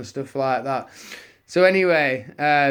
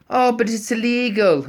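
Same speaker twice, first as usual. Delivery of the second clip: mock-high, louder prissy cop voice; young Garda; 9 semitones higher, 6 dB louder than usual. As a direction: parody a by-the-book rookie. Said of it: "He went".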